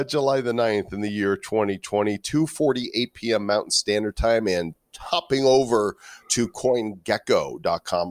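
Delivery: usual speaking voice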